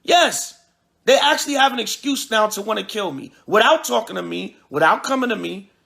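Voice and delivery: ordinary talk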